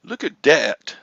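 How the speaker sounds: "dat" with a hard D for th; Philly Flipper. Retold or imitated. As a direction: say "dat" like a Philadelphian, D not TH. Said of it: "Philly Flipper says stuff like".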